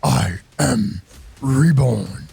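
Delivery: low, slow demon lord voice; booming demon lord. Acted. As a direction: proclaim slowly, low and commanding. Demon reborn demands kneeling.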